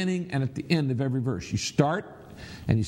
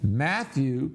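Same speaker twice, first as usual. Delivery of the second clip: ordinary talk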